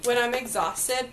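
usual speaking voice

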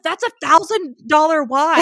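host scolding a wine thief, hammed-up whine; mock outraged complaint. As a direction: wail it like a wounded connoisseur. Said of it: "he's like".

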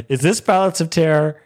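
growing excitement. Retold or imitated. Fan recognizing a classic episode, growing excitement. Repeats question with rising excitement.

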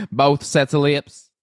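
a hint of a Southern drawl; light Southern belle voice; Southern accent. Mimicking Sutton in a light Southern accent. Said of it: "You have a good Southern accent".

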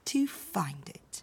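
hushed whisper to close the tale. A narrator's reading. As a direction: breathe the last words out. Soft ending of the story.

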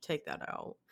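unvoiced whisper of the request; voiceless hush quoting her friend; whispered quote. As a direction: breathe it like an aside. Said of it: "she'll be like".

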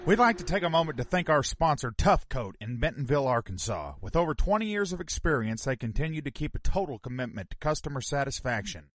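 measured radio-style advertisement; sponsor read. Real, not acted. Reads a sponsor message.

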